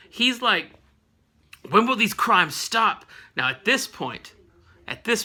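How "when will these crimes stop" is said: usual speaking voice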